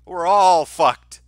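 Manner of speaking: slow, loud mock lament; mock doom. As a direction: drag it out like a hammy doom call.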